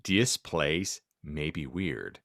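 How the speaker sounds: ordinary delivery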